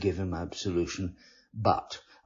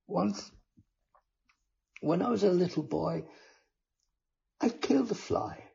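mild mock tears, higher; tearful confession; 9 semitones higher, faster than usual. A narrator's reading. Penitent to the friar, hammed-up tearful. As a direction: confess with a catch of fake tears. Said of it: "dissolved convincingly into tears".

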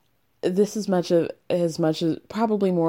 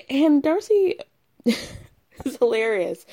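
slow, higher, laughing through the words; 7 semitones higher, slower than usual. Real, not acted. Podcast host to listeners about Darcy, laughing as she talks. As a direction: giggle it out, delighted. Laughing over reality show moment.

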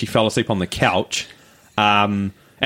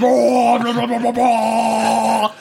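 mock possessed babble; 8 semitones higher, much slower than usual. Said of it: "weird tongue thing".

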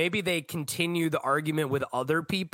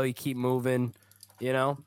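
normal talking voice

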